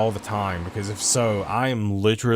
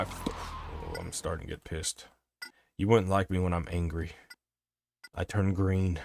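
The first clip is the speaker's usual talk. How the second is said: much softer, lower, low-key threat; mock menace; 5 semitones lower, 8 dB quieter than usual. Streamer joking to the game he'll Hulk out, soft low threat. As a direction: murmur it like a half-joking warning.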